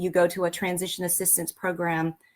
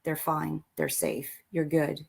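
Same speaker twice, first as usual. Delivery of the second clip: soothing reassurance; 3 semitones lower, 4 dB quieter than usual. Retold or imitated. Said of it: "wake me up and say".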